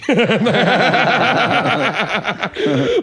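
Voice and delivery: high laugh at his own joke; laughing at the posh bit; real laugh. Real, not acted. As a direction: burst out laughing.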